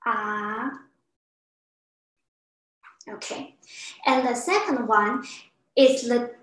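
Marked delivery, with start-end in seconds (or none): 0.1-0.7 "ah" sustained on one even note; open-mouthed dentist's "ah", held steady; level tone. Shown as a demonstration. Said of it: "make the sound ah".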